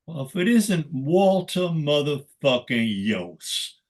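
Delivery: slow, drawn-out mock taunt of a ballplayer. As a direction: stretch it out like a teasing veteran. Retelling Parker taunting Walter.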